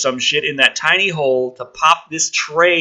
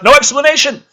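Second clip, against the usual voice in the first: exclaimed; 11 semitones higher, 8 dB louder, faster than usual. Acted. Exclaiming while reading the script.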